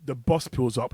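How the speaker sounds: ordinary talk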